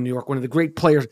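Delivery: normal talking voice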